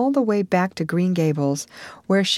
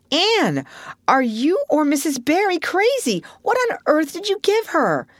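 incredulous; 11 semitones higher than usual. Acted. Marilla incredulous.

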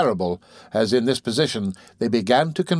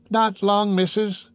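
high crone voice; an old pauper answering her mistress; old woman voice; 8 semitones higher, slower than usual. Acted.

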